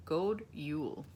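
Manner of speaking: God Jul said in Swedish; Swedish greeting. Shown as a demonstration. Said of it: "and they say".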